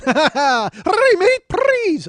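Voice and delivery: high, loud gargled Australian plea; Roger Rabbit. Acted. Comedian reprising his drowning cartoon Australian, loud high splutter. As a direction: splutter like a cartoon character going under. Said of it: "Roger Rabbit drowning in Australian".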